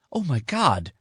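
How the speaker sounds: high startled exclamation; sudden realization. Acted. Sydney suddenly realizes the mailbox still has it, startled. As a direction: it just hit her.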